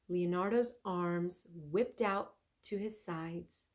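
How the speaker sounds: usual speaking voice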